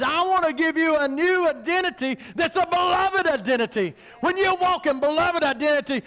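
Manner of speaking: high-pitched, driving revival sermon; full-force preaching. Real, not acted. Preacher urging the church to walk in identity, full-force preaching. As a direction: declare it with mounting fervour.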